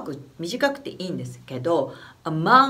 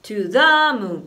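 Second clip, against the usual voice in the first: "to the moon" slowly, the kept short, moon louder; rhythm demo; 6 dB louder, much slower than usual. Shown as a demonstration. Teacher showing the lyric rhythm to students, slow. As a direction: stretch "to", clip "the", land on "moon". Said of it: "この踏め方".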